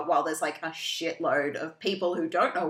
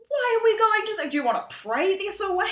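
incredulous; 13 semitones higher, 3 dB louder than usual. Retold or imitated. Essie incredulous.

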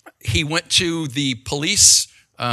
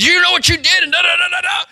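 much higher, louder mock yell of a sweaty cop; imitating angry cop; 6 dB louder, faster than usual. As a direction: bark it like the red-faced officer at the window. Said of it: "that's what he said".

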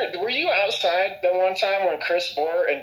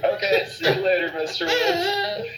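laughing farewell. Retold or imitated. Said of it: "I'm like".

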